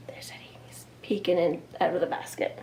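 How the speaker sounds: quick hushed whisper. Real, not acted. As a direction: whisper it to the little listeners. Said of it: "whispering aside to children".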